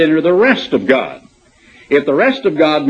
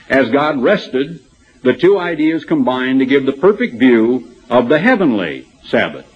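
ordinary talk

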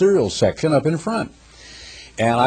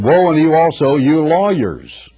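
louder, slowing on lawyers; preached rebuke; 5 semitones higher, 5 dB louder than usual. Acted. Preacher thundering Christ's woe at the lawyers.

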